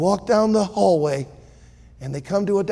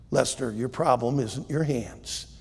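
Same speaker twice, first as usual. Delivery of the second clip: calm doctor; 6 semitones lower, 7 dB quieter than usual. Acted. Doctor Law answers Lester.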